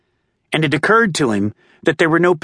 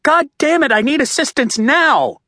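much higher, urgent yell for backup; strained yell; 12 semitones higher than usual. A narrator's reading. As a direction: cop losing grip, yelling now. Cop struggling yells for help.

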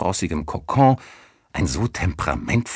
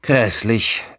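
pitched-up groan of dismay; groaning disgust; 5 semitones higher, 3 dB louder than usual. A narrator's reading. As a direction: groan it out in distaste. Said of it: "Im Armlehnenstuhl stöhnte es". (angewidert gestöhnt)

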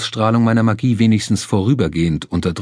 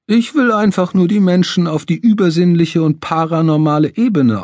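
theatrical medium; 8 semitones higher than usual. Acted. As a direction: pitch it like a stage psychic. Medium Mortimer voiced.

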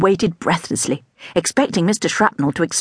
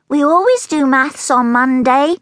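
higher, slow pupil voice; child voice; 8 semitones higher, 3 dB louder than usual. A narrator's reading. Rebecca telling the teacher the routine, higher and slow. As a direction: state the rule like a careful pupil.

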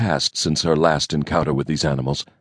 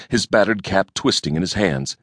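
usual speaking voice

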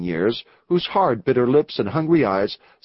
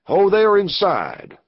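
higher, drawn-out hail; calling out; 7 semitones higher, 3 dB louder, slower than usual. A narrator's reading. Stranger calls from the darkness to those inside, drawn-out hail. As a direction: call slowly into the cabin, pitched up. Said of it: "called a voice from the darkness".

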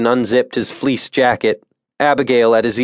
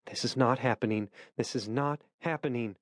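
very soft disbelieving murmur; inner thought; 16 dB quieter than usual. A narrator's reading. Abigail watching Jared fall, very soft stunned murmur. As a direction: barely voice it, numb in disbelief.

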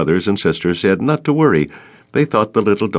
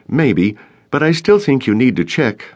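insisting; 6 semitones higher than usual. Acted. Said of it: "Gus insisted".

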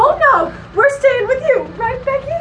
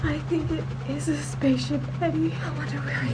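hushed awe; 8 semitones lower, 10 dB quieter, faster than usual. Acted. Hushed at spaceship sighting.

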